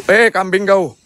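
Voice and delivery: high, loud startled yell at a goat; startled shout. Real, not acted. Vlogger startled by a goat, shouting at it loud and high. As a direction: jump and shout at the animal.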